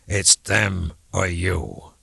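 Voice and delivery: very slow, drawn-out menace, each word weighed; menacing threat. Acted. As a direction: lean in and threaten him slowly. Bly threatens Andy, finger at heart.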